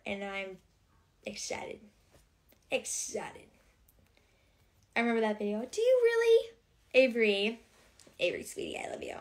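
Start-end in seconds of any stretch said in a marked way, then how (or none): 0.0-1.8 breathy whisper of the word excited. Real, not acted. Whispering to viewers about her Christmas trip, hushed. 8.2-9.2 soft, unvoiced whisper; tender whisper. Real, not acted. Whispering love to viewer Avery on stream, soft.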